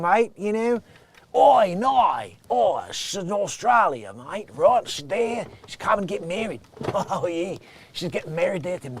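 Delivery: hammed-up Australian twang; attempted Australian accent. Comedian jokes about the Australian bride getting married, attempted Australian accent. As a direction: put on a rough, joking Australian accent. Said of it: "I gotta try to get my Australian down".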